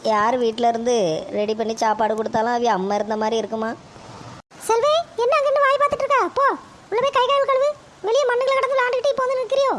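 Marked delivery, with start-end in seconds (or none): 4.7-9.7 scolding daughter-in-law. Acted. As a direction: scold the child sharply in a young woman's voice. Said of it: "மருமகளே, போய் coffee கொண்டு வாமா".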